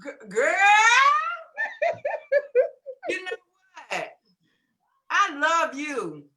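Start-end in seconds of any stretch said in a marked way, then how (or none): 0.6-3.0 big high burst of laughter; loud high laugh. Real, not acted. [name] greeting the group, loud high laugh.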